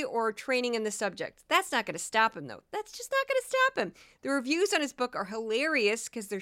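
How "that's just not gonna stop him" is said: much higher, put-on voice; high-pitched mock aside. Podcaster mocking a bogus expert's lack of training, high-pitched. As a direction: repeat it way up high, comically.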